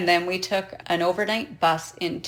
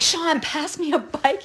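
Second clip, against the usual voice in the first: high, slow call; 9 semitones higher than usual. Retold or imitated. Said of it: "And I'm like".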